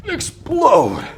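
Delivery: very high pitch swooping down; straining, effortful lifting cue; exertion call. Real, not acted. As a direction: heave the word out on the lift. Calls out while lifting the weights.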